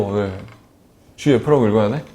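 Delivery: usual speaking voice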